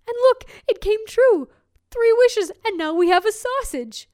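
discover the magic and burst with excitement; husband telling his wife the fairy's wishes came true; excited husband. Acted.

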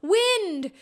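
high call of wind; boy crying out over the edge of the world. A narrator's reading. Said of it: "cried the boy".

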